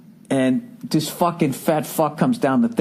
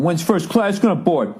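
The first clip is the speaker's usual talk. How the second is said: huffy complaint; 3 semitones higher than usual. Retold or imitated. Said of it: "they're getting all huffy".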